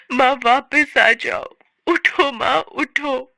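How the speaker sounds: high, crying pleas to a dead mother; tearful pleading. Acted. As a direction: sob it out, begging her to come back. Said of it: "वो रो रो कर माँ, माँ चिल्लाने लगती है।".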